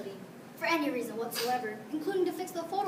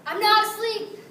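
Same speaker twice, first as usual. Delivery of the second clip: higher, loud insistent shout; emphatic shout; 7 semitones higher, 12 dB louder, slower than usual. Retold or imitated. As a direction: insist loudly you're awake. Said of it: "adding for emphasis".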